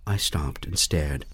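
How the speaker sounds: ordinary delivery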